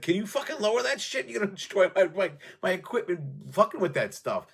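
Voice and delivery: high, irritated shout at the guitarist; angry studio owner. Retold or imitated. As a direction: snap at him to turn it down.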